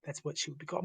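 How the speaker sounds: ordinary talk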